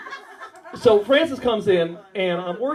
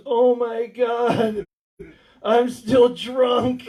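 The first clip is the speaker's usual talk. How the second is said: put-on drunk groan; 3 semitones higher, much slower than usual. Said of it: "She goes".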